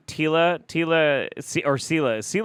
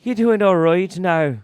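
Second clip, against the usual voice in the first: hammed-up Irish lilt, higher and louder; mock Irish accent; 10 semitones higher, 7 dB louder than usual. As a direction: tease him in a stage Irish brogue.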